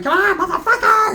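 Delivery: high yelled challenge; fight yell. Retold or imitated. Dwarf Wood yelling during the brawl. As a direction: bark it at him, ready to fight. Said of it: "Dwarf Wood was going".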